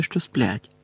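ordinary delivery, nothing marked

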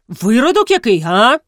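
high, loud affronted question; indignant challenge. Acted. Колісник challenges Загнибіда's insult, indignant challenge. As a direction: demand it back, swooping up in outrage.

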